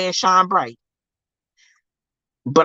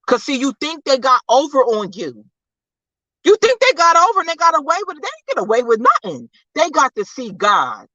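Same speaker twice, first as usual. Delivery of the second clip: high, rapid preacher's rebuke; impassioned preaching; 9 semitones higher than usual. Real, not acted. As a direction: push it out fast and high. Preaches against those who hurt them.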